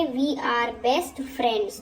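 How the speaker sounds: very slow, word by word; slow model sentence. Shown as a demonstration. Teacher showing students how to say it, slowly. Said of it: "तो कैसे बोलेंगे?".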